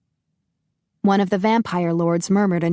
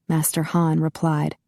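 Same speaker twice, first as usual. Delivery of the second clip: ordinary delivery, nothing marked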